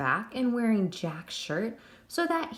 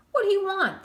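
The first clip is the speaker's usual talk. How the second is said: much higher, louder fearful cry; frightened cry; 12 semitones higher, 6 dB louder than usual. A narrator's reading. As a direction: cry out at the ghost. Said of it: "the deputy cried".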